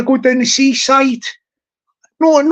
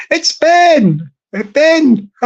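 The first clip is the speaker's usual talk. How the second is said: higher-pitched garden call; mock shout; 4 semitones higher, much slower than usual. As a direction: call your name out over the fences, jokingly. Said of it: "and shout out".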